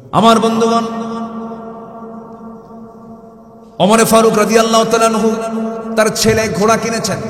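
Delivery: high, loud fervent preaching; preaching at full force. Acted. Preacher urging the faithful, fervent shout. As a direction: preach it out to the crowd with fire.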